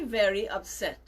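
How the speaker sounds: ordinary delivery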